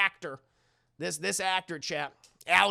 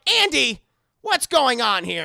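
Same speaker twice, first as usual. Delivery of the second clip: loud, higher demanding aunt; 5 semitones higher, 10 dB louder than usual. Retold or imitated. Aunt Maggie demands to know why the doll moved, loud. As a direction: catch him out.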